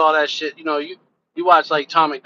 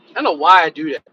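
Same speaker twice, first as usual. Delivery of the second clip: normal talking voice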